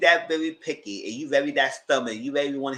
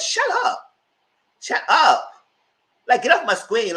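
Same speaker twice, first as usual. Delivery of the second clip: loud, high, exasperated shout; fed-up shout; 11 semitones higher, 6 dB louder, slower than usual. Retold or imitated. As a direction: yell it at the TV, sick of her. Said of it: "I said".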